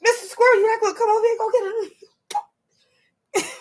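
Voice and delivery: a mock whisper to the other squirrel; teasing hushed question to a squirrel; hushed aside to squirrel. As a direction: whisper it like a conspirator. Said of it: "I'm like".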